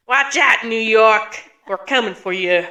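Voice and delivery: loud hammed-up New York bluster; New York accent. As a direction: shout it like a Brooklyn tough guy. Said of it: "a Midwesterner trying to do it a New York accent".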